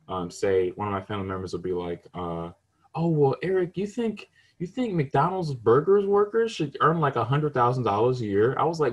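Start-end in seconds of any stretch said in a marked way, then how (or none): 2.9-8.5 high mock scoffing; high smug mock relative. Mimics mocking family member.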